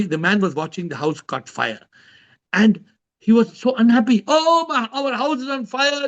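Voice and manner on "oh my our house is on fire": high mock wail of dismay; hammed-up distress, pitched high. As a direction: play the owner wailing over his burning house. Man retelling house fire story.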